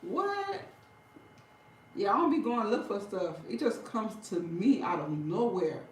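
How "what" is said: sudden high squeak of shock; retold shock. Retelling a shocking discovery, high squeak. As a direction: squeak it in disbelief. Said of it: "I'll be like".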